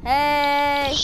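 ordinary talk

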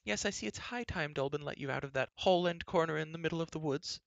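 high, disapproving queen voice; tut-tut at the girl's state; fussy queen. A narrator's reading. Queen scolds Elenwy.

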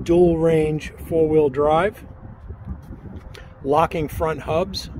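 usual speaking voice

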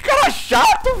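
high, drawn-out frustrated cry; fed up and shouting; exasperated yell. Real, not acted. Annoyed by monster game.

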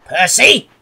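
loud, high summons; angry shout. A narrator's reading. Fat controller calling the culprit, angry shout. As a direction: shout the name in anger. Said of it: "he shouted".

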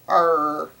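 loud pirate growl; pirate arr. Pretend. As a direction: bellow it like a pirate. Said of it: "Talk Like a Pirate Day".

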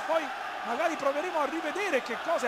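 usual speaking voice

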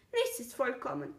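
understated sigh of resignation. A narrator's reading. Fox to the little prince about imperfection, lightly sighing. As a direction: let it out with a mild sigh. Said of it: "seufzte der Fuchs".

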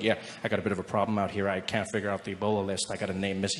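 a hint of a worried official, voiced low; official's voice. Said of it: "He's like".

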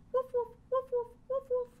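high, loud playful woofs; puppy barking. Pretend. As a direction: bark like a little puppy. Teacher imitates a barking dog.

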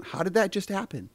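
high-pitched imitation of a baffled kid; Stella. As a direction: ask it high, in childlike disbelief. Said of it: "'Cause, like, she's asking".

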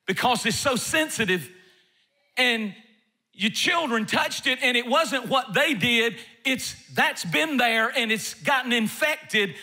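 high, driving sermon cadence; impassioned preaching. Acted. Preacher urging the church to let old hurts go, fervent. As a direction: preach it to the congregation with fire.

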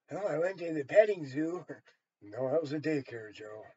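normal talking voice

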